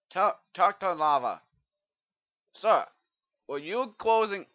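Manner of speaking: loud, hammed-up British shouting at a villager; British accent. Player scolds a Minecraft villager, loud mock British accent. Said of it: "I sound like the person from Britain".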